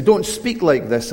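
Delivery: slow stern order; stern command. Retold or imitated. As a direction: forbid them sternly. Rulers shut them down.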